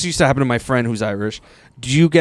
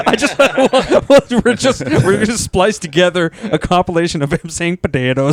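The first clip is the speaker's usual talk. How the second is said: laughing; 5 semitones higher than usual. Real, not acted. Laughing at the potatoes clip.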